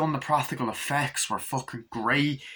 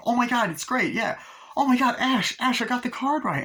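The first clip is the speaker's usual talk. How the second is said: high, imitated girlish thrill; mock excitement; 11 semitones higher than usual. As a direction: gush as the delighted girlfriend. Acts girl thrilled guessing a card.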